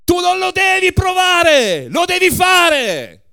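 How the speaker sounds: ex-KGB giant roaring at the waitress; furious shout. A narrator's reading. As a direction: bellow it at the waitress. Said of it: "E gridò".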